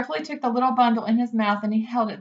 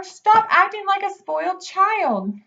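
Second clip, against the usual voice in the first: angry scolding shout; 9 semitones higher, 4 dB louder than usual. Acted. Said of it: "screamed Wilbur".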